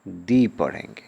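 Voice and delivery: "दी" with a long ee, like "thee". Shown as a demonstration. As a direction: stretch the vowel in "दी". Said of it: "क्या पढ़ेंगे". ("दी" लंबी ई के साथ)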